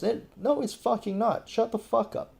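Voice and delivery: pitched high, snapping back in real anger; angry outburst. Real, not acted. Ranting at people who call the junta communist, angry outburst. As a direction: cut them off, fed up and furious. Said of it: "that was a bit too emotional".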